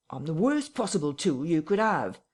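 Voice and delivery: frightened tramp pleading with his captor; near tears. Acted. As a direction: beg like he might cry any second. Marvel protests miserably.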